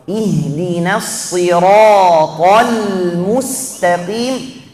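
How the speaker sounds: slow melodic recitation of Ihdinas siratal mustaqim; Quran recitation. Shown as a demonstration. Teacher recites the verse for students, slow and melodic. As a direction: chant it slowly like in prayer. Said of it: "Jelaskan dhammat mim".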